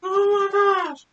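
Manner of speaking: usual speaking voice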